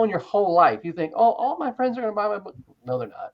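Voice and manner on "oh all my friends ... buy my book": mock naive voice, much higher; high, starry-eyed mimicry of a hopeful newbie; naive author. Author mocks a naive writer's hopes on a podcast, high mock voice.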